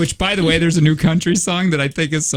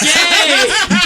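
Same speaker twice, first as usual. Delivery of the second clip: mock excited cheer; 14 semitones higher, 4 dB louder than usual. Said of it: "And he's like".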